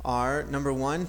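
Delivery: ordinary talk, nothing marked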